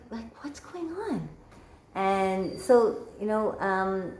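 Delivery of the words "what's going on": high, hushed question; baffled aside. Retold or imitated. Woman looking at her husband, softly baffled. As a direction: ask it softly in a high, bewildered voice. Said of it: "I'm just like".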